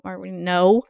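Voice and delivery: ordinary talk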